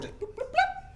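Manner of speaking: squeaky high bloop noises; high silly bloops. Pretend. Joking about the lovers' quick hookup, squeaky bloops.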